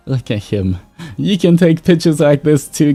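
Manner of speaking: ordinary talk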